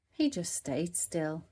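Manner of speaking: plain reading voice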